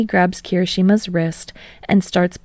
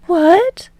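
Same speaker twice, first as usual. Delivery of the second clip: high, louder startled question; surprised Yaoyorozu; 12 semitones higher, 5 dB louder than usual. A narrator's reading. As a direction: caught off guard. Voices Yaoyorozu.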